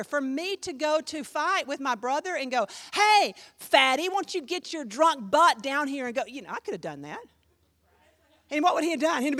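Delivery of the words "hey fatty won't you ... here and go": loud mock challenge, stretched opening call; mock confrontation. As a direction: pick a fight, hammed-up and loud. Imagined picking a fight with brother.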